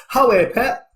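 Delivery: high, hammed-up Geordie hero; mock Geordie accent. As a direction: play a Geordie action hero, half laughing. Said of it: "Real North English hero".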